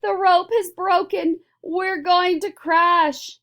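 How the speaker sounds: high, loud cry of alarm; panicked cry. A narrator's reading. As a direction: shriek in fear of the fall. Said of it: "Missus T V cried out".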